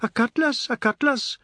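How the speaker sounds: high loud urgent call. A narrator's reading. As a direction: call for a cutlass. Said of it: "Sharma's widow called".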